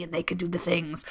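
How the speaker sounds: whispered mockingly, as if sharing a secret; mock whisper. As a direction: breathe the words out with a mocking tone. Mocking those "embodied" folks.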